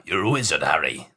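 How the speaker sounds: put-on deep, low West Country Hagrid accent; lightly done Hagrid accent. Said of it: "I lost the accent".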